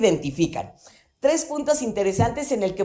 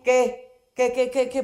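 mock offended; 3 semitones higher than usual. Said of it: "ya me lo tomé personal".

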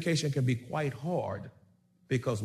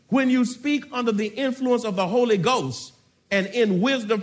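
louder, ringing sermon delivery; preaching at full force; 2 semitones higher, 6 dB louder than usual. Acted. Preacher proclaiming scripture to the church, full-force preaching. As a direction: preach it out to the congregation.

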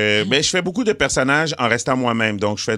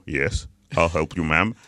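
low English-speaking movie-star voice; Eddie Murphy; 6 semitones lower, slower than usual. Acted. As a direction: drop low and answer in cool English. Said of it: "En anglais, Eddie Murphy, il répond". (voix grave d'acteur américain, en anglais)

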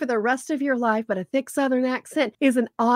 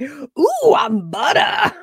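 [name]; 4 semitones higher, 5 dB louder, much slower than usual. Retold or imitated. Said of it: "The voice, baby, is what that is".

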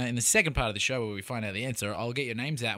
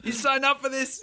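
mock skit voice; 11 semitones higher than usual. Acted skit continues.

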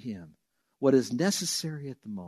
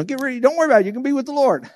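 high, loud mock sermon; loud, high parody of other preachers; 11 semitones higher, 8 dB louder, much faster than usual. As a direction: lampoon the fear-based reading. Continues mocking that interpretation.

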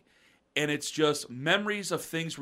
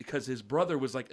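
ordinary talk

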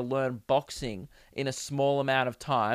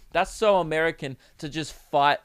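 ordinary delivery

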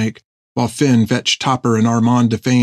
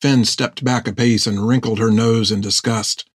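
plain reading voice

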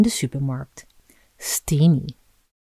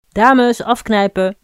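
loud, higher bark through the door; toilet attendant shouting; 5 semitones higher, 6 dB louder than usual. Acted. Toilet attendant yelling at the women in the stall, loud and higher. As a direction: bang on the door and yell at them. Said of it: "riep de toiletjuffrouw".

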